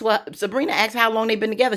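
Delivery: ordinary delivery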